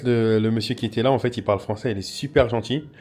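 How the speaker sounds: usual speaking voice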